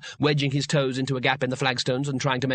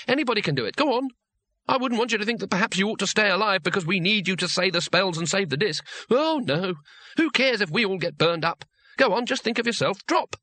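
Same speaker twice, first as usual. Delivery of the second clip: higher, sneering mock taunt; mock scorn; 7 semitones higher than usual. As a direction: taunt him into holding on. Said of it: "It was time for harsh measures".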